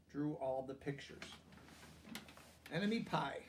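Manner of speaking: ordinary delivery, nothing marked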